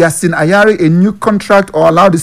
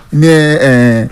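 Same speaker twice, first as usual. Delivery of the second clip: ordinary talk, nothing marked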